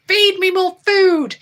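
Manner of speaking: high, slow mock demand in the cat's voice; cat's pleading voice, hammed up; voicing the cat. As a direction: play the greedy cat, drawn out up high.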